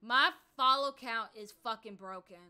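angrier repeat. Real, not acted. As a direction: push the frustration up a notch. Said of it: "Like, I'm really pissed off right now".

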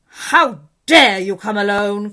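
put-on furious queen scolding the boy; terrible voice. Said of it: "in a terrible voice".

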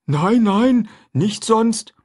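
a simple, eager man talking food; runaway man chatting with the boy; Jim's voice. A narrator's reading.

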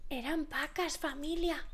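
ordinary talk

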